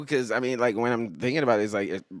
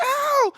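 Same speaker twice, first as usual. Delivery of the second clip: very high, loud "ow"; acted pain cry; 8 dB louder than usual. Retelling a dog bite to the ankle, high mock yelp. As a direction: yelp as if just bitten, pitched way up. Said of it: "And I was like".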